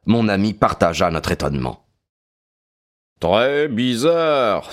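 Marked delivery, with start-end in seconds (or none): 3.2-4.7 faraway voice over the phone; slow, as if down a phone line; distant voice. A narrator's reading. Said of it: "fit la voix lointaine".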